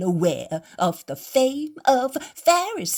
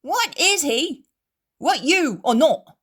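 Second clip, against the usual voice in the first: high, loud furious defiance; angry innkeeper; 10 semitones higher, 8 dB louder than usual. A narrator's reading. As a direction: stand your ground and throw them out. Innkeeper throws out the Pharisees.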